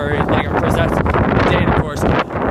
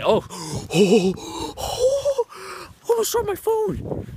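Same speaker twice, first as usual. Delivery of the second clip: gasping, voice jumping way higher; real fright as the phone nearly falls; startled gasp; 14 semitones higher, much slower than usual. Real, not acted. Vlogger nearly drops the phone, gasping in real surprise. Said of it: "I almost dropped my phone".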